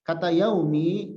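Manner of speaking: ordinary delivery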